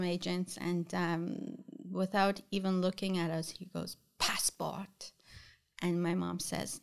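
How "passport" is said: hushed, offhand official request; curt customs demand. Retold or imitated. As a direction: ask for it quietly, not even looking up. Customs agent asks without looking.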